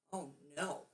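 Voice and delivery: ordinary delivery, nothing marked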